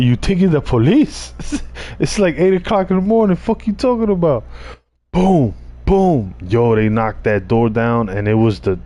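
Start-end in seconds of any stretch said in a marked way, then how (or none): 0.0-4.4 high, fast disbelieving scoff with a laugh; mock him like the idea is absurd; scoffing at his buddy's police fear; incredulous. Scoffing at friend's police guess. 5.1-6.3 high mock door bangs again; imitated banging. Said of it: "and I hear bangs".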